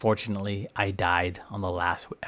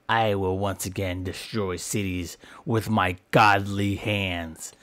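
usual speaking voice

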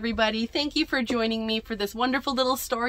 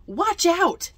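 high, loud storybook shout; yelled warning; 9 semitones higher, 5 dB louder than usual. A narrator's reading. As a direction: yell it out as an urgent warning. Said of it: "yelled Sancho".

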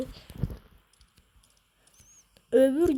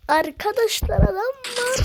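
higher and louder warning to the viewers; excited alert; 7 semitones higher, 4 dB louder than usual. Real, not acted. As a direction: call out the enemy in a sudden alarm. Spots an enemy. (heyecanlı uyarı)

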